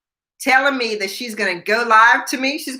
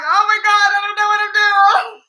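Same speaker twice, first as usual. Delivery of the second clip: very high, hammed-up cry of panic; panicked wail; 11 semitones higher, 3 dB louder, faster than usual. Rookie reporter to producer after freezing live, panicked wail. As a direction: wail it helplessly, way up in pitch.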